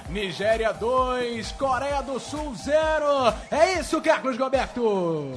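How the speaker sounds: loud, slow, high goal call; explosive goal commentary. Real, not acted. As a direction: belt it out like a stadium goal. Goal replay after Nigeria scores.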